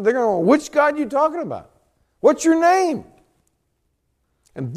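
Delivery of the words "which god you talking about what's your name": high, loud mock interrogation; acted-out challenge, pitched right up; challenging demand. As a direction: demand it like a doubting crowd. Acts out the people challenging Moses.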